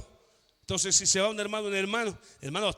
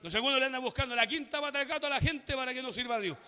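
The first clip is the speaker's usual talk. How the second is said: usual speaking voice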